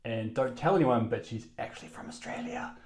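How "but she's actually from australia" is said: softly whispered confidence; mock secret whisper. Host introducing co-host's secret origin, mock whisper. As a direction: whisper it like a secret not to be told.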